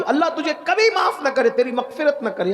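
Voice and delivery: high, emphatic cursing; retold curse. The wronged girl curses the shroud thief, imitated bitterly. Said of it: "اس نے بد دعا دی".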